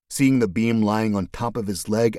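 plain reading voice

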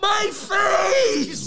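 tearful high impression of an injured man; imitated anguished wail. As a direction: wail it in hammed-up agony. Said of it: "And Eric's going".

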